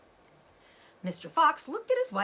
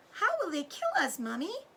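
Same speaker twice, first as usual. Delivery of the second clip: put-on much higher frightened child voice; a scared little cub asking; small fox child; 10 semitones higher than usual. A narrator's reading. Cub asks mother how they'll die, scared child voice. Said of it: "said one of the small foxes".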